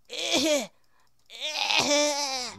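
loud, high straining "eh" noises; effortful grunts. Pretend. Player trying to push the cow, loud straining grunts. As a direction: grunt as if shoving something.

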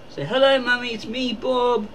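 high-pitched talking-pet voice; playful cat greeting Mummy in a high voice; Bob the cat. Pretend.

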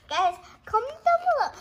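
high, loud call to viewers; excited appeal. Real, not acted. Kid urging viewers to comment. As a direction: shout it out to the audience.

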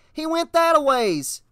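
put-on high, urgent boy's call; shouting back. A narrator's reading. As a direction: call out which way he fled. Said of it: "Danny shouted back".

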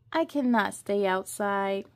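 ordinary delivery

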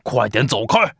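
loud, brusque dismissal; short annoyed storybook shout; shouting at brother. A narrator's reading. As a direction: bark at little brother to clear off. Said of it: "冲着弟弟叫道".

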